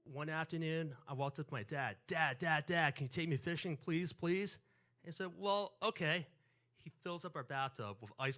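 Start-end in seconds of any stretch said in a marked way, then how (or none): 2.1-4.6 put-on loud, insistent kid pestering dad; pleading child. Child begging his dad to go fishing. 5.4-6.3 imitating dad's louder, higher agreement; dad giving in. Dad gives in to begging child.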